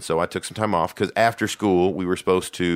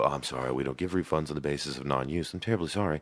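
much softer, gentle customer-service voice; soothing soft apology; 5 semitones lower, 9 dB quieter than usual. Shown as a demonstration. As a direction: let them down very softly.

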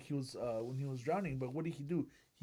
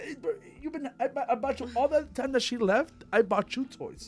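higher and louder, a petty big-brother complaint; jealous older brother; 9 semitones higher, 7 dB louder than usual. Acted. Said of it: "an older brother gets mad at a younger sister".

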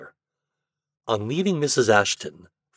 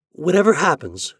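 begging; 7 semitones higher, 4 dB louder than usual. Acted. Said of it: "she begged".

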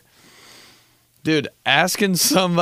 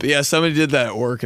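ordinary talk, nothing marked

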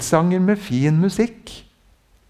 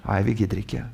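put-on low, soft, bored whine of sleepy children; tired kids; 7 semitones lower, 6 dB quieter, faster than usual. Preacher voicing kids refusing the game, bored whine. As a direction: drag it out like a child who won't play. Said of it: "Som trøtte unger like før leggetid".

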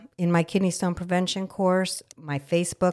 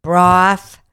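loud rant; 8 dB louder, slower than usual. Real, not acted. Ranting about dark liquids.

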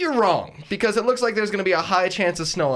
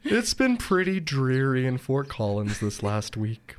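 slow, low, gloomy donkey drone; Eeyore voice; 4 semitones lower, 4 dB quieter, slower than usual. Pretend. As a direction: drag it out like sad Eeyore. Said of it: "emulate Eeyore here".

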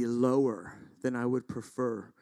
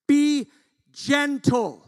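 high, loud plea on each word; emphatic preacher's exhortation; forceful urging; 13 semitones higher, 8 dB louder, much slower than usual. Acted. Preacher loudly orders gentleness as Paul.

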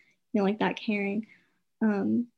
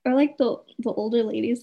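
ordinary talk

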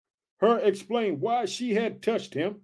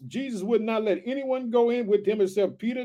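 usual speaking voice

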